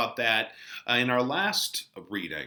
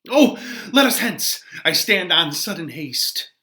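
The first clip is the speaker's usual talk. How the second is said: dramatic Shakespeare reading; 4 dB louder than usual. Acted. Teacher reads Friar Laurence's lines to the class, dramatic verse reading. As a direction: read the verse aloud with heightened, louder stage delivery.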